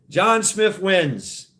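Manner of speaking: drawn out, slow winner call; slow game-show reveal; slow announcement. Acted. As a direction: stretch each word like a host. Announcing the winning name.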